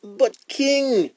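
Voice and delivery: high, protesting snake voice; python's cry. Acted. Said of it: "he cried".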